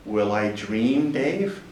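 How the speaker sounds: low, slow, soft computer voice; slow right down, voice low and quiet; HAL. Retold or imitated. Quoting the film computer being disconnected, low and slow. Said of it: "when they're disconnecting Hal".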